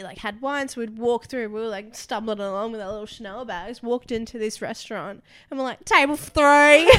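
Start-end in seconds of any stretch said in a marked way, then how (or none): 5.8-6.9 loud, high, very drawn-out mock holler; drunken calling out. Drunk friends asking for a restaurant table, loud and slurringly slow. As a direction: bellow it slowly like a tipsy customer.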